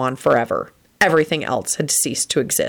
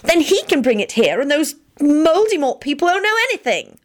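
high, eager rush of an idea; girlish excited squeal; 12 semitones higher than usual. A narrator's reading. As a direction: pitch it up with delight. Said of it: "shrieked excitedly".

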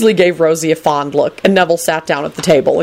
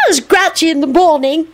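put-on high childish announcement; happy little boy; 12 semitones higher than usual. A narrator's reading. Teddy announces Lupin's grouchiness, high and happy. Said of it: "Teddy announced happily".

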